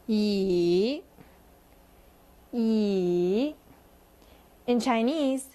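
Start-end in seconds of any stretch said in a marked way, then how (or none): 0.1-1.0 "Yi" dipping low, then rising; third tone. Shown as a demonstration. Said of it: "bring it down and then bring it back up". 2.5-3.5 "Yi" dipping low, then rising; third tone. Shown as a demonstration. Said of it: "bring it down and then bring it back up".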